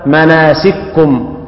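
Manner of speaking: very slow مناسككم with one doubled kaf; kaf merged into kaf. Shown as a demonstration. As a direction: read مناسككم as مناسِكُّم. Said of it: "فيقرأ هكذا".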